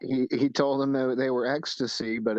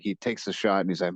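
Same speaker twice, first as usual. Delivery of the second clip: usual speaking voice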